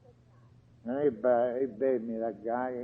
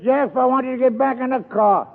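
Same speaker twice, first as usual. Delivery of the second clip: firm insisting; 6 semitones higher, 5 dB louder than usual. Retold or imitated. Friend insisting he get in.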